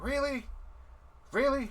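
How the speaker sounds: high-pitched incredulous question; disbelief. Acted. Dwarf reacting to the dice game, incredulous.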